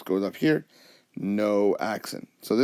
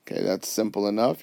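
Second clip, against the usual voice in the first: usual speaking voice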